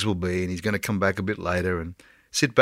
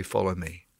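soft whispered follow me, lower and hushed; whispered call; 4 semitones lower, 7 dB quieter than usual. Retold or imitated. Preacher voicing God calling the listener, whispered. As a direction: breathe "Follow me." as a whispered invitation.

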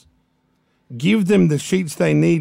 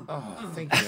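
quiet, breathy mock moan; soft moan; 9 dB quieter, slower than usual. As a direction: sigh it out like a pleasured moan. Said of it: "I wanna hear the noise".

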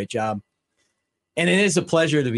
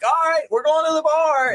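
high, loud mock announcement; hammed-up party call; mock cheerful; 4 dB louder than usual. Teammates telling Rhino they're leaving, high mock. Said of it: "we'd be like".

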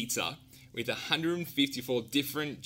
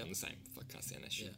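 whispered; 13 dB quieter, faster than usual. Real, not acted. Confiding aside about licorice.

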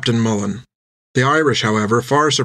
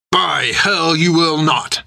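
a lightly voiced snarl of refusal; a touch of a snarling sailor, understated; 5 semitones higher, slower than usual. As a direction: bite off the refusal like a bully. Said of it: "snarled Jack".

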